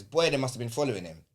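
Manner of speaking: usual speaking voice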